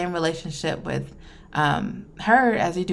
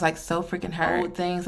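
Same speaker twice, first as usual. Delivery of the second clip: ordinary talk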